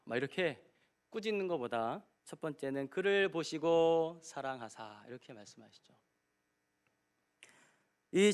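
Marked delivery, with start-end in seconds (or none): 2.9-5.0 slow, weighted reading. Shown as a demonstration. Repeats the verse slowly.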